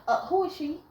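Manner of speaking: high, overly polite question; fake-polite who is she; sweetly suspicious. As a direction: ask nicely while fuming inside. Politely asking mid-hug.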